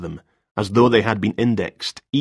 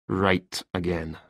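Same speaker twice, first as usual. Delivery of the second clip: quieter, slow and bitter; hint of bitterness; 3 semitones lower, 7 dB quieter, slower than usual. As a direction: let a touch of bitterness in. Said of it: "A hint of bitterness had crept into".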